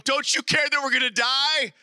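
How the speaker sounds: high, acted panic; mock-frantic outcry; freaking out. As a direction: plead in alarm as a frightened disciple. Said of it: "they're freaking out and they're going".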